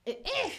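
high-pitched serve grunt; tennis grunt. Shown as a demonstration. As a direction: cry out as you hit the serve. Relance le service avec cri. (cri aigu de service)

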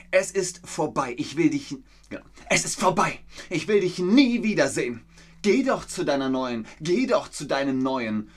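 hammed-up jealous scene; acted breakup fight. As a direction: throw the partner out in a rage.

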